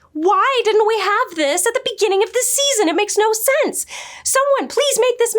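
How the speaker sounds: high exasperated disbelief; exasperated gush. Real, not acted. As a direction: plead it, overwhelmed by cuteness. Repeats the complaint, more worked up.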